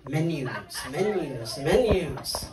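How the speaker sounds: low, soft repeated single word; hushed, repetitive low chant. Retold or imitated. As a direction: impersonate a brother quietly repeating himself. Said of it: "my brother goes like this".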